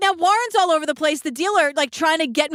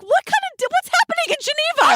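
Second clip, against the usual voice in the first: baffled high squeak; 13 semitones higher than usual. Retold or imitated. Podcaster squeaking in disbelief at Geneva's reputation. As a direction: voice shooting way up in disbelief. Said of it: "I'm like".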